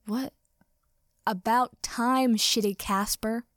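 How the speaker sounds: plain reading voice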